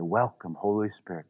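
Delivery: soft, low murmured prayer; under your breath. Shown as a demonstration. Preacher modelling a prayer said under the breath. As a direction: breathe the words quietly, inward. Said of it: "under your breath".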